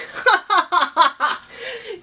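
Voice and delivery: high, loud mocking laugh; mocking rabbit laugh. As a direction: laugh right in the turtle's face. Rabbit mocks the turtle.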